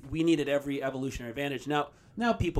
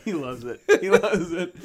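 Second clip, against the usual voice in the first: laughing; 3 semitones higher than usual. Real, not acted. Laughing at friend.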